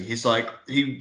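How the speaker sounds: ordinary talk, nothing marked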